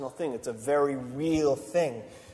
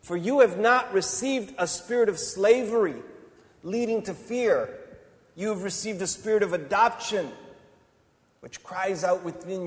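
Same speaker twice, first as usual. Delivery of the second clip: loud, high exhortation; fiery preaching; 5 semitones higher, 5 dB louder than usual. Acted. Preacher urging listeners to press on, loud and high. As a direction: drive them on like a revival preacher.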